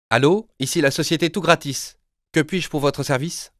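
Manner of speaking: a polished telephone operator voice; company phone voice. Acted. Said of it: "dit la voix".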